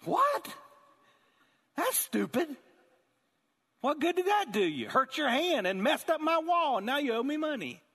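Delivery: hammed-up friend scolding the tenant; incredulous outburst. Friend retelling his call with the tenant, incredulous scolding. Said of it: "He's like".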